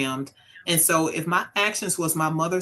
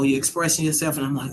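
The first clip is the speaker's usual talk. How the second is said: usual speaking voice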